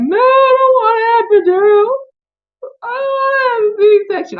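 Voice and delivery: high, loud tearful pleading; crying refusal. Retold or imitated. Refusing the C-section to the doctor, high and crying. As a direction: sob out the refusal, begging. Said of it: "I was legit crying".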